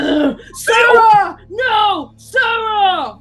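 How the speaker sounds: loud high anguished shout; anguished hero cry. Retold or imitated. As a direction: cry out the name in despair. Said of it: "he just be like".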